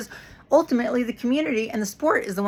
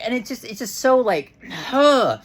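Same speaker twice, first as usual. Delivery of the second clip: usual speaking voice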